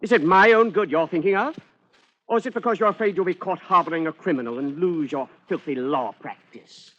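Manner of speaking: scornful accusation. Acted. Fugitive accusing his lawyer of cowardice, sneering. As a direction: throw the accusation at him.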